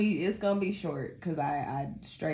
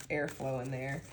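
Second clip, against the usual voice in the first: usual speaking voice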